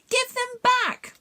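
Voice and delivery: put-on small boy shouting at the bullies; shouted. A narrator's reading. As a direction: demand it back with a yell. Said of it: "shouted a familiar voice".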